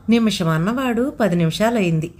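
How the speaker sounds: ordinary delivery, nothing marked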